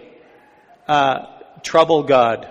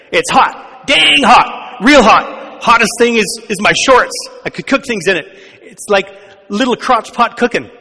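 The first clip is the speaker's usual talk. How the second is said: louder, higher comic complaint about the heat; overheated soldier; 5 semitones higher, 5 dB louder than usual. Retold or imitated. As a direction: ham up a soldier melting in the desert. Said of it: "picture the Israelites there in the desert".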